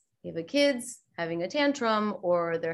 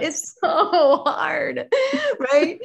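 mock sympathy; 8 semitones higher, 5 dB louder, slower than usual. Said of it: "you want someone to be like".

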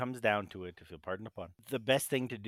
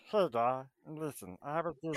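cartoon voice; 2 semitones higher than usual. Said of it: "I can do the voice".

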